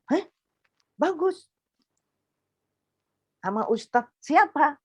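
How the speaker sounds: high, startled question from the brother; surprised brother. Retold or imitated. Brother on the phone hearing about the teacher, high and startled. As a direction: jump up in pitch, caught off guard.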